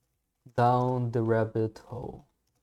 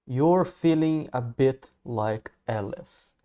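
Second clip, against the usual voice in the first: slow model reading; slower than usual. Shown as a demonstration. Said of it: "repare no jeito que ele fala aqui".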